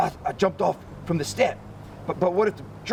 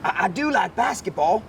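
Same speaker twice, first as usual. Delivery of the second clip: lightly voiced nervous boy, a bit higher; the boy talking himself out of it, a touch higher; boy's voice; 7 semitones higher than usual. Boy on tiger's back hedging to get off, lightly voiced nervous boy.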